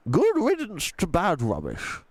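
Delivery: put-on gentle old tramp voice, a different timbre; old tramp Mister Stink. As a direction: speak like a kindly old vagrant.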